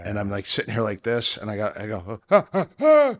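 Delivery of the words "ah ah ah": loud, high gasps of alarm; panicked gasps. Retold or imitated. Reliving a panic attack on the plane, panicked gasps. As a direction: gasp in sudden panic, very high.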